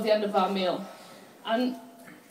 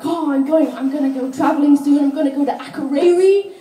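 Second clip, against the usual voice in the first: higher, louder, excited tourist; mocking an eager tourist; 4 semitones higher, 6 dB louder than usual. Comic imitating a tourist bragging about travel plans, high and loud. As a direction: gush about the trip. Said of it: "They're like".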